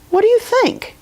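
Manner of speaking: plain reading voice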